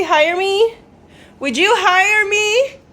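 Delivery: high, loud coy asking; playful pleading. Pretend. Asking viewers if they'd hire her, high and coy. Asks viewers about outfit.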